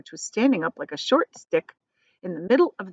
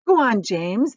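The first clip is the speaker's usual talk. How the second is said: plain reading voice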